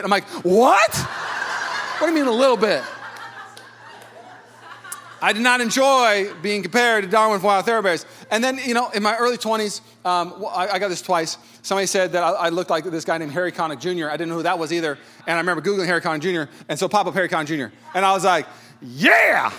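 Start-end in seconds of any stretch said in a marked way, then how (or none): 0.4-2.8 loud, high, rising shocked what; hammed-up disbelief; mock outrage. Acts shocked at friends' answer. 18.8-19.5 loud swooping yeah; hammed-up approval; mock delight. Acts delight at flattering comparison.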